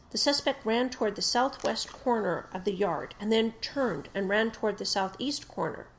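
usual speaking voice

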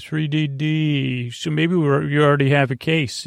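ordinary delivery

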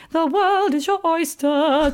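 high, slow, sing-song grandeur; dreamy mock fanfare; grand mock proclamation. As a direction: pronounce it like a fairy-tale promise. Ironic quote of childhood message.

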